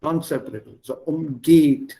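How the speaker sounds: umgeht with weight on geht; umgeht, second syllable stressed. Shown as a demonstration. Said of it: "Stress is on the second syllable".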